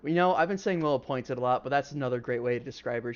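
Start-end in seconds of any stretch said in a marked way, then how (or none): none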